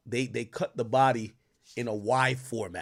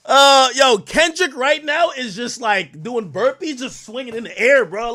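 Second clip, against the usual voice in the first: amused excitement; 10 semitones higher, 9 dB louder than usual. Real, not acted. Cracking up over the clip.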